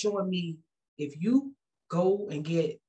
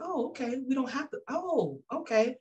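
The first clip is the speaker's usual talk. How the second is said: mock surprise; 8 semitones higher than usual. Her high mock reaction.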